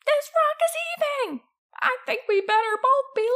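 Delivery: very high, loud panicky villain; high-pitched storybook panic; alarmed cry. A narrator's reading. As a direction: squeal it in mounting alarm. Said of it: "cried Hugh".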